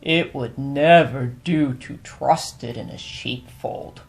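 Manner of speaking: lightly voiced blind man, slow and measured; a hint of a thoughtful old judge weighing his words; pondering blind man. Blind man weighs the cub in slow, measured voice.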